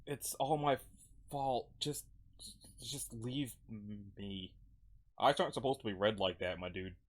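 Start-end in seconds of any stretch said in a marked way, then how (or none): 0.0-4.5 halting, defeated delivery; guilt-ridden character. Acted. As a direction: break it up haltingly, fading at the end. Acts guilt-ridden Odin, broken phrases.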